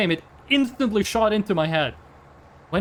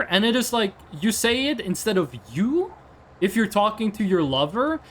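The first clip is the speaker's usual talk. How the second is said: ordinary talk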